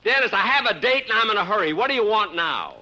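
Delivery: annoyed man late for a date; impatient. Acted. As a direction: rush him off the phone. Impatient, in a hurry.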